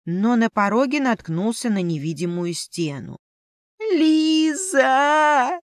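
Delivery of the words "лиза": high, long-drawn plaintive wail; moaning. A narrator's reading. Familiar shut out in the corridor calls Liza, moaning. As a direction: moan the name out, stretched and high. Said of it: "простонал он".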